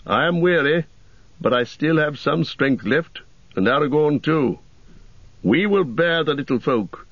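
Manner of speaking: encouraging warrior voice; rally them with hope; Boromir voice. Acted. Boromir speaks to hobbits.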